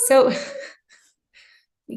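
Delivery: breaking into a laugh mid-sentence; real laugh escaping as you explain; amused, losing it briefly. Real, not acted. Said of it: "that's why I'm laughing".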